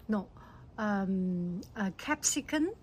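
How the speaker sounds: ordinary talk, nothing marked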